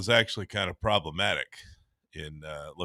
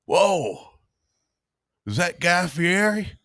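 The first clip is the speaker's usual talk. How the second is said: starstruck onlooker; 9 semitones higher, slower than usual. Retold or imitated. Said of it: "were like".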